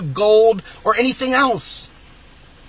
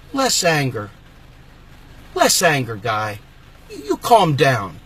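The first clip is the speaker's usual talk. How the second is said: mock tone police; 8 semitones lower, slower than usual. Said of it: "looks over at you and goes".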